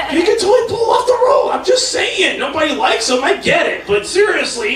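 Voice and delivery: high, fast, nervous protest; flustered. Real, not acted. Comic defending the bit to the crowd, high and fast. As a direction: defend yourself in a rush.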